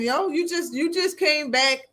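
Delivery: ordinary talk, nothing marked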